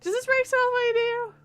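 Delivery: hammed-up creepy kidnapper, high and loud; cartoonish kidnapper line, high and loud. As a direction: lean in like a creepy kidnapper with a rag. Repeats chloroform line in voice.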